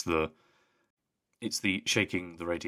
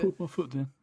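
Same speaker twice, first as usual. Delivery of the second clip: higher mock-stern echo; mock-stern dad; 9 semitones higher than usual. Friend echoes the dad's line, mock-stern. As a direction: repeat it like a pushover dad. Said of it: "echoing the dad line".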